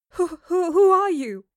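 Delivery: high, loud stammer of shock; stuttering. A narrator's reading. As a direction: stammer on the first word in alarm. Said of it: "Jane stuttered".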